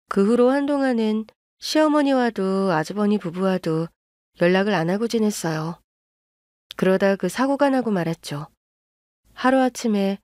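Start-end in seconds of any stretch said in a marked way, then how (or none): none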